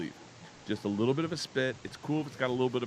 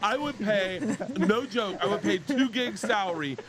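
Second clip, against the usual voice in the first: delighted; 11 semitones higher than usual. Real, not acted. Host thrilled by the college story, raised excited gushing. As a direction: gush about it, way up high.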